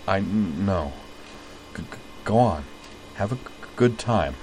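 halting stutter, soft and unsure; stammering shyly. Acted. Caitlin shyly welcoming Jury along, stammering. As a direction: trip over every start, nervous.

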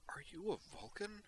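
put-on high, small voice of a wide-eyed little girl; awestruck girl. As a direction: gasp it out in wonder like a child. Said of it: "she asked, awestruck".

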